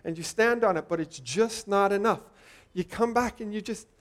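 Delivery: ordinary delivery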